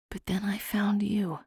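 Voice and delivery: soft whispered admission; whispering. A narrator's reading. Fae's confession, whispered.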